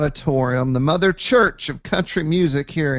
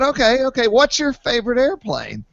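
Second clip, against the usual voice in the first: much higher, excited quiz; eager question; 9 semitones higher than usual. Retold or imitated. Testing the Uber driver, eager and high-pitched. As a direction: fire it off keen and high.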